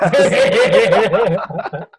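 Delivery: loud, long burst of laughter; a big laugh. Real, not acted. Laughing at the podcast joke.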